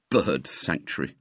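low, grumbled musing; bitter thought. A narrator's reading. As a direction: grumble it privately to oneself. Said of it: "he thought".

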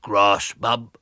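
lightly put-on throaty boy; a boy playing dumb in a fake voice; deep throaty voice. A narrator's reading. William in disguise baiting Miss Milton, mild throaty voice. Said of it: "he said in his deep, throaty voice".

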